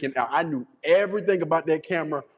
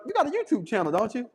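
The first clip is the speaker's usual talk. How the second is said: curious question; 8 semitones higher, faster than usual. Retold or imitated. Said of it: "was like".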